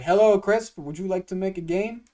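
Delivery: loud, high, badly faked impression of a friend; James. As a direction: botch a New Zealander's cheery invitation. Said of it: "how to do a James impression".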